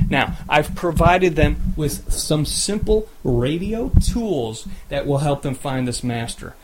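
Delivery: ordinary delivery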